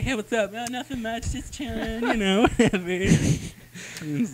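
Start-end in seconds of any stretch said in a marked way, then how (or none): 0.0-2.8 high, lazy, laid-back mock dude; slacker dude voice. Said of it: "He's like, he's like".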